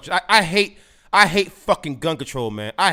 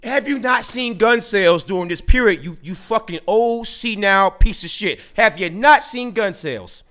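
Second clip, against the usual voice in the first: loud, higher, slow furious shouting; furious rant; 4 semitones higher, 6 dB louder than usual. Real, not acted. Yelling at Biden over gun sales, furious and loud. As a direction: tear into him with real rage.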